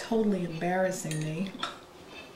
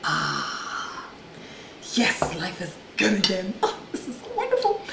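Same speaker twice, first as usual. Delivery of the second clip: delighted laugh. Real, not acted. First bite of the cake, delighted.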